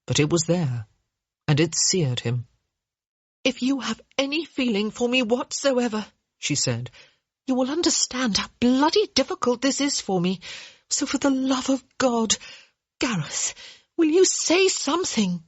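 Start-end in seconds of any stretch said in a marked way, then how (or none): 3.4-6.1 higher, urgent woman's voice; pleading young woman. Acted. Hyacinth pleading with Gareth. 7.5-15.4 higher, urgent woman's voice, rising; pleading young woman. Acted. Hyacinth pleading with Gareth.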